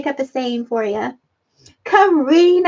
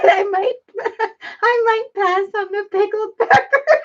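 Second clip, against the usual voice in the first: high, tearful laughter breaking up the reading; laughing so hard; 5 semitones higher than usual. Real, not acted. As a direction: read it out while cracking up. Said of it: "I'm laughing so hard".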